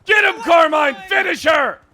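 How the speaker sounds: high, loud cheering yell; shouting. Real, not acted. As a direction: yell them on to finish. Cheering on a fight.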